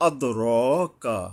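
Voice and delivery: আদরকা slow, bouncing d and long ro; qalqalah and stretch. Shown as a demonstration. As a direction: bounce the দ in আদরকা, then draw out র. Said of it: "দালের উপরে কলকলা". (আদরকা দালে কলকলা ও রয়ে টান দিয়ে পড়া)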